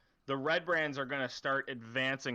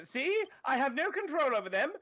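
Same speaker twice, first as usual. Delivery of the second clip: much higher, pleading official's voice; nervous town official; 9 semitones higher than usual. Acted. Threatened official insists to the player he can't command the guards, high and pleading. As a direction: squeak out the denial of control.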